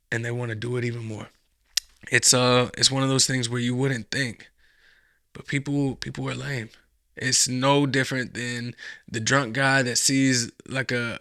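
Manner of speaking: ordinary delivery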